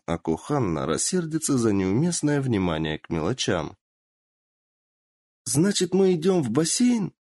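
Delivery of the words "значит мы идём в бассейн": put-on high girlish squeal of excitement; delighted. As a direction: bounce with joy on the question. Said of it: "подскочила на месте от восторга".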